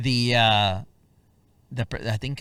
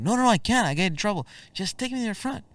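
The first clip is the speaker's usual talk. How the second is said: refusing driver; 11 semitones higher, faster than usual. Retold or imitated.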